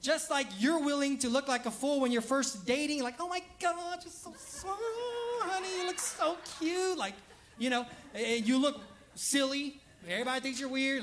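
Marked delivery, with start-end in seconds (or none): 3.2-7.0 gushing new lover. Retold or imitated. Mocking new couples gushing.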